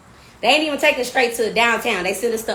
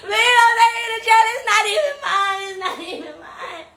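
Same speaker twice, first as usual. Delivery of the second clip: mock pleading; 9 semitones higher than usual. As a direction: plead like being arrested. Pleading with cops.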